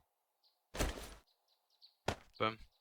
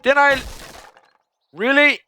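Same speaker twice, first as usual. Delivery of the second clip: indignant shout; 10 semitones higher, 8 dB louder than usual. Real, not acted. Outraged shout at another player.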